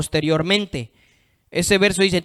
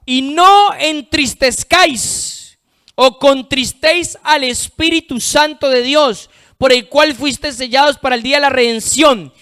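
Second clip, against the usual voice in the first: higher, projected scripture reading; lectura bíblica predicada; 6 semitones higher, 5 dB louder than usual. Real, not acted. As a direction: proclaim the verse to the congregation, higher pitched. Lee un versículo predicando. (lectura proclamada de la Biblia)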